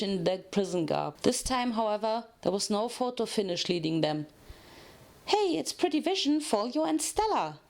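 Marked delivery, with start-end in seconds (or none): 5.3-7.6 a touch of a higher excited greeting; Pinky greeting old friends, mildly excited; cried out. Acted. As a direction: call out to familiar faces, lightly. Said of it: "Pinky cried".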